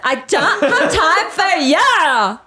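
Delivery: slow, drawn out mock Anna accent; playful Anna Delvey impression; imitating Anna's accent. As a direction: lay on the show's odd accent, slow. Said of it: "Have you gotten to".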